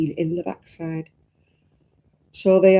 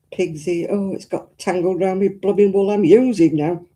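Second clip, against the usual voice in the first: ordinary talk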